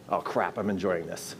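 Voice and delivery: low soft laughing aside; laughing grudging mutter. Retold or imitated. Skeptic at the seminar, low laughing mutter. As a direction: admit it under your breath.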